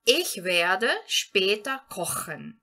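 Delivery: German read very slowly and clearly; slow model sentence. Shown as a demonstration. Teacher gives the next example sentence to students, very slowly. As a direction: lay out each word slowly. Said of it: "Então, a frase poderia ficar".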